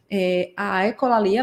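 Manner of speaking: normal talking voice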